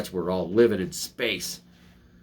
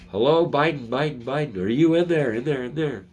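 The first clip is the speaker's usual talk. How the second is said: higher, louder sing-song calling; impression of calling Biden; 5 semitones higher, 3 dB louder than usual. Vlogger mocking Biden, high loud mock calling. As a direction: knock on his head and call out, mocking. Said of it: "that's my impression".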